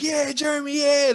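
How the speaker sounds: high, hammed-up excited cheer; excited greeting. Cousin spotting Jeremy at the surprise, mock-excited call.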